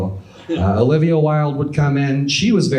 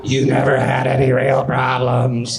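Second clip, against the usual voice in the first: whiskey-addled voice. Retold or imitated. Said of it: "my whiskey and cigarette addled voice".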